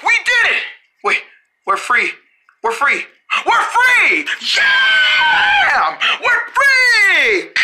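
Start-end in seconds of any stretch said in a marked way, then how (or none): 0.0-0.6 excited. Acted. Escaped the paper balls. 3.5-7.5 excited shout. Acted. Celebrating escape.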